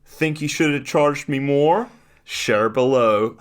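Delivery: high and slow, selling it; a cocky kid asking for likes; smug kid voice. Retold or imitated. Voicing the boy's post.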